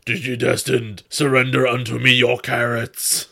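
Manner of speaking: booming villain voice, slightly far-off; evil voice. Pretend. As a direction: loom over them like a hammy villain. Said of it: "the evil voice".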